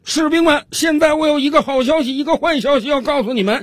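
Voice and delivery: high-pitched drill instructor addressing the troops; loud announcement. Acted. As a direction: proclaim it to the recruits in a high, put-on voice. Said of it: "训练军是大声宣布道". (教官高声宣布)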